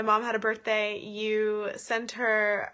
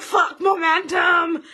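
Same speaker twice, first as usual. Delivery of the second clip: loud slow exasperated yell; frustrated shout; 8 semitones higher, 10 dB louder, slower than usual. Retold or imitated. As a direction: bellow it at the hills. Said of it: "you were just like".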